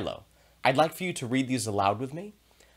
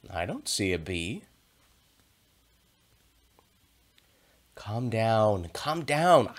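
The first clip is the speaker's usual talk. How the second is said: calm cat Milo; slower than usual. Acted. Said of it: "and I will be Milo".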